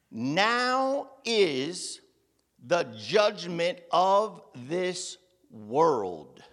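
slow preacher's solemn proclamation; solemn scripture reading. Acted. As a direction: let each word fall slowly.

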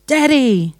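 high cry of "Daddy", falling away; joyful shout. Acted. Jesus hugging the old man in the joke, shouting. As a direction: shout it out, drawn from the reunion. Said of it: "and shouts".

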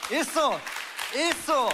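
excited agreement. Real, not acted. Enthusiastic agreement in interview.

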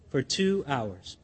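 low, slow and soft on two hours; slow hushed stress on "two"; quiet emphasis. Real, not acted. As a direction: lean in and drop the voice. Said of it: "emphatic aside".

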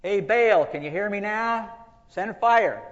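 very loud, high-pitched holler to the heavens; shouting. Acted. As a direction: shout at full volume for a god's attention. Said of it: "they started shouting louder and louder".

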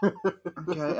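a high laughing "okay". Retold or imitated. Caught in a diaper, laughing okay. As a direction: giggle through the word. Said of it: "I'm like".

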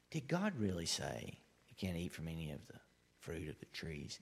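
the crafty serpent sowing doubt in Eve; sly serpent. Acted. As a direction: lure her in like a serpent. Preacher voicing the tempting serpent.

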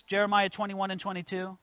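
ordinary talk

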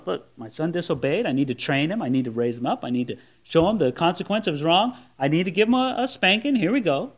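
calm father. Retold or imitated. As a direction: speak like a father who stays cool before a spanking. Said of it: "he's cool about it".

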